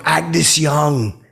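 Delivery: high, excited star-struck call; excited shout. Retold or imitated. Fan spots rock star and blurts his name, excited shout.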